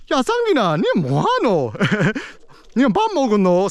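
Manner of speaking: high, twangy Busan dialect with big upswings and downswings; Busan uncle impression. Imitating her Busan uncle calling her name, twangy and high. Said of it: "My Busan uncle is like".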